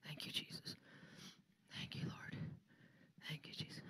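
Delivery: hushed whispered worship; whispered prayer. Real, not acted. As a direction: whisper thanks to Jesus under the breath. Quiet prayer during giving.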